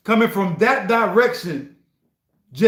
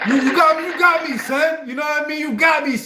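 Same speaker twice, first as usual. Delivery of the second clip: excited; 8 semitones higher than usual. Real, not acted. Streamer conceding to the producer Ram, high excited calls. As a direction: cry out, caught by the trick. Delighted the track fooled him.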